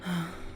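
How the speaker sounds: soft tired sigh out through the nose; a small sigh of unease; weary sigh. Acted. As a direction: let out a quiet weary breath. Tense pause before confiding.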